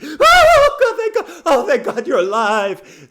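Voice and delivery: high, loud hammed-up crying wail; mock tearful relief. Mom answering the long-awaited phone call, hammed-up tearful relief. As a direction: wail it like an overwrought mother. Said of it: "really crying and could barely speak".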